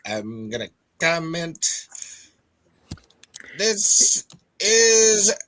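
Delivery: put-on slow, grand old Hollywood drawl; transatlantic accent. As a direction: drawl it like a glamorous movie star. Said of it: "transatlantic accent".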